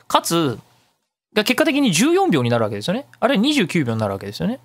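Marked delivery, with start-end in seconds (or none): none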